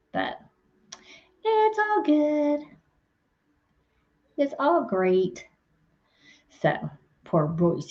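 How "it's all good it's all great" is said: drawn-out, higher "it's all good"; slow, lilting, easing down; sing-song reassurance. Real, not acted. Vlogger soothing viewers that it's fine, sing-song reassurance.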